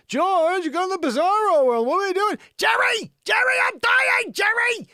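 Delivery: very high, loud frantic yelling; hammed-up screamed sitcom panic; Seinfeld character impression. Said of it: "or was this Seinfeld?".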